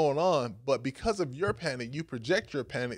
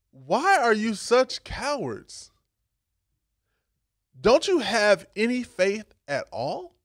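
slow rebuke; 6 semitones higher, 4 dB louder, slower than usual. Retold or imitated. Jesus rebuking the disciples.

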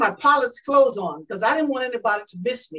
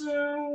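soft, sustained engine drone; plane engine hum; 7 dB quieter than usual. Acted. Pilot doing her figure eights, soft imitated engine hum. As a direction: hum the plane's motor softly and steadily.

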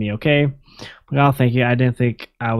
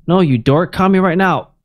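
higher and louder, playful urgency; excited teasing; 6 semitones higher, 4 dB louder than usual. Retold or imitated. Friend teasing Alex and demanding a call, excited and louder. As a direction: tease him, then insist he call now.

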